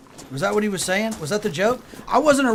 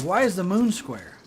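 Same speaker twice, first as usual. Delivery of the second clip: ordinary talk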